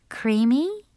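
high rising question; unsure Cinderella. A narrator's reading. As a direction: guess it with a questioning lift. Cinderella guesses the name.

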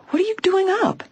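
very high, startled question; astonished gasp. A narrator's reading. Just-woken girl sees the injured housekeeper up, gasping in astonishment. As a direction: shoot the pitch way up in surprise. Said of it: "she gasped".

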